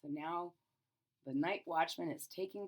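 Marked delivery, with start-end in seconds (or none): none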